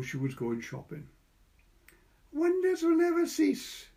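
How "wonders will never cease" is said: put-on high, loud child's voice; boy's voice. A narrator's reading. Little boy marvelling aloud to himself. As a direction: voice the small boy, bright and pleased.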